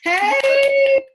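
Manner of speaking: high, loud sung compliment; excited greeting. Real, not acted. Host greeting her guest on screen, sing-song and loud. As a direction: croon the compliment, then call hey.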